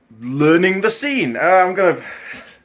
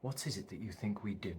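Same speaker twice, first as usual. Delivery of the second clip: low, very soft, resigned film monologue; hushed and deep, with long heavy pauses; doomed man's monologue; 9 semitones lower, 23 dB quieter than usual. Acted. Performs Equilibrium monologue to camera.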